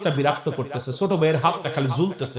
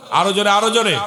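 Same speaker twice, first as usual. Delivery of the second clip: louder, higher call for a response; urging call; 5 semitones higher, 6 dB louder than usual. Real, not acted. Preacher prods the congregation for more volume, urging call. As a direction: rouse the crowd to answer.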